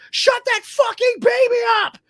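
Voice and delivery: very high, loud furious shout; angry shout. Retold or imitated. Lady in front yelling at the baby's mother, furious shout. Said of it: "just turned around and goes".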